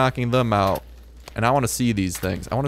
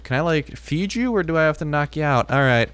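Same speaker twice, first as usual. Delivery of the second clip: normal talking voice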